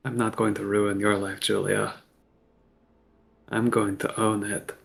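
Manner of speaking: softly, under the voice to himself; very quiet. Acted. Talion speaking to himself about Julia, very quiet. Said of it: "He'll say very quietly to himself".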